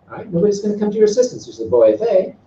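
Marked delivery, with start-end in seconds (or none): 1.6-2.3 boefe with weight on its last syllable; stress ending boefe. Shown as a demonstration. Teacher shows the "she's helping" form, end-stressed boefe. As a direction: land on the final syllable of boefe. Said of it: "she's helping".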